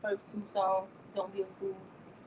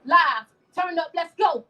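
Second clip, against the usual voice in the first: hype shout; 8 semitones higher, 10 dB louder, slower than usual. Real, not acted. Hype call to the crowd.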